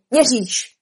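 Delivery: short, firm snap; snapped retort. Acted. King contradicts Alice, snapping. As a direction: snap it back in one stroke. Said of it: "Král odsekl".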